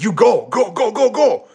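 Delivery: high-pitched mock anger, shooing someone away; imitated angry saleswoman. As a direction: mimic a mad vendor chasing him off. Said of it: "the salesperson was mad at me".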